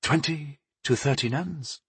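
soft, high-pitched pondering; musing. A narrator's reading. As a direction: mull the number over quietly. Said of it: "she mused".

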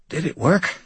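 high, rasping and exhausted; raspy voice. A narrator's reading. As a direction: rasp it out, drained. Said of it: "Mort rasped".

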